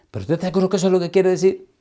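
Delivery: rushed, high mock doubt; incredulous question. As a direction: ask it like you can't believe the verse. Doubting student questions again.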